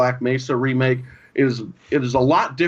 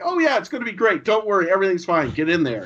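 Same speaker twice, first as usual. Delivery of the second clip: quick, high breezy assurances, easing down; mock reassurance; 5 semitones higher, faster than usual. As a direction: imitate someone hurrying a nervous person along. Voices scientists falsely reassuring the player.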